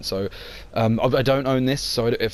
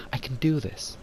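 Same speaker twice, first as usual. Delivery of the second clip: breathy whisper of determination; whispered resolve; 6 dB quieter than usual. Acted. Lara convincing herself she can film, soft whisper. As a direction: whisper it to yourself.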